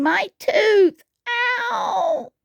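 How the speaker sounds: high mock scream of toothache; high, acted cry of pain. A narrator's reading. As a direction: wail over a sore tooth. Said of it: "Tiny screamed".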